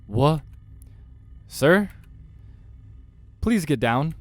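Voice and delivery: loud, high startled calls; alarmed. Acted. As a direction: call out in alarm to stop a customer. Waiter startled as customer climbs table.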